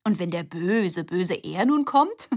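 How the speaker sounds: hammed-up dread, slowing on böse, böse Er; playful fake fear, loud and slow; mock spooky. A narrator's reading. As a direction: pretend to be scared to tease. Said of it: "Cecilia tat so, als ob sie sich fürchtete".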